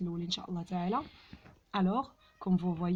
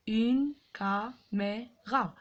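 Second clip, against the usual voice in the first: "Une caméra" in slow separate beats, une, ca, mé, ra; syllable by syllable; much slower than usual. Shown as a demonstration. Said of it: "frappez les syllabes".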